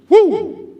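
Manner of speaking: high, loud "woo"; exasperated whoop. Acted. Parent on three kids getting on nerves, exasperated whoop.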